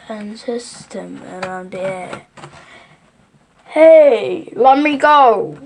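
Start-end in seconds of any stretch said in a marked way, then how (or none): 3.8-5.6 loud, higher-pitched protesting yell. A narrator's reading. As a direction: yell it out as the trapped crocodile. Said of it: "yelled the enormous crocodile".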